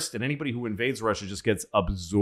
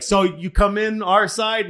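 much higher, playful asking voice; mock Roosevelt question; 11 semitones higher, 4 dB louder than usual. Roosevelt asking Stalin to join, high playful voice. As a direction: coax him in, pitched high. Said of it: "F D R is like".

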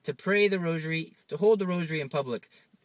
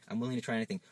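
quiet giving in; 4 semitones lower, 6 dB quieter, much faster than usual. Pretend. Friend agrees.

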